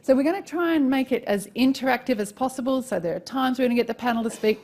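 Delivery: normal talking voice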